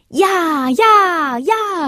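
high, drawn-out cheers; calling out together. A narrator's reading. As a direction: shout yes like a crowd of little stars. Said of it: "riefen alle Sterne".

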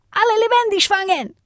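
shouted command. A narrator's reading. A fighter orders the charge on the wolves, shouting. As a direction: cry the order out high over a fight. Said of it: "schreit einer".

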